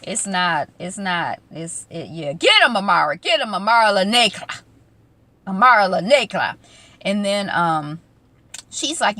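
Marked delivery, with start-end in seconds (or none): 2.3-6.6 loud, punchy hype; cheering her on. Pretend. Host rooting for Amara in the argument, loud cheering. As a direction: egg her on like a ringside fan.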